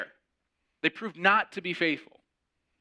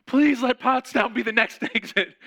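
loud, high, pleading through a laugh; desperate mock plea; 10 semitones higher, 7 dB louder than usual. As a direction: beg the heavens half laughing. Exasperated prayer on endless bus ride.

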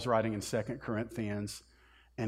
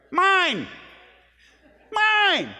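much higher, louder squealing "Mine!"; grabby toddler; 9 dB louder than usual. Retold or imitated. Pastor imitates a toddler claiming a toy, high squealing shout. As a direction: shriek it like a two-year-old grabbing a toy. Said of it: "you've seen two-year-olds".

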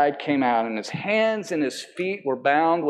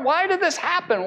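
much higher, pleading question; anguished why; 13 semitones higher than usual. Retold or imitated. A suffering believer asks God why, pleading. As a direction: cry it up to God in distress.

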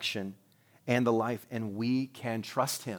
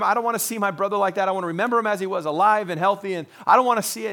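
higher and louder, fast worried pleading; Martha pleading; 7 semitones higher, 7 dB louder than usual. Retold or imitated. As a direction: Martha begging, don't open the grave. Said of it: "Martha comes along and says".

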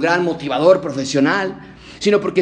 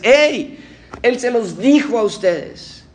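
reproachful angel; 5 semitones higher, slower than usual. Acted.

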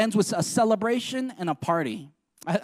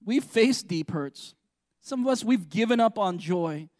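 usual speaking voice

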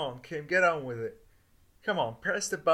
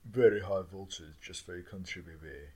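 seductive voice; 8 semitones lower than usual. Acted. Sultry voice playing Juncker's lover.

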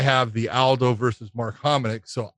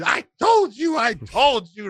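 excited gloating; 10 semitones higher than usual. Retold or imitated. Seagal shaking the narrator, crowing over his prediction, high and excited. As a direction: crow it over and over like you called it. Seagal grabbing him, celebrating fight.

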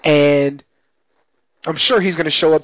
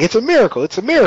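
higher, excited cry of wonder; mock amazed exclamation; 8 semitones higher, 3 dB louder, much faster than usual. Retelling what the first guy in church says, mock amazed. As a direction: imitate an awestruck churchgoer. Acted congregation member proclaiming a miracle.

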